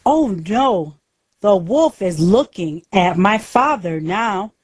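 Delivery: ordinary delivery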